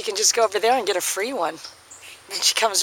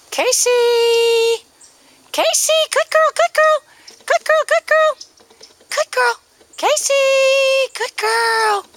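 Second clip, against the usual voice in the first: calling the dog; 7 dB louder, slower than usual. Real, not acted. Recall training calling the dog.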